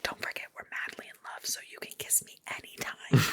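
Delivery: fast whispered tease. Retold or imitated. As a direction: whisper it close to her ear. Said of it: "he whispers".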